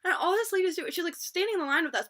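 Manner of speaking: normal talking voice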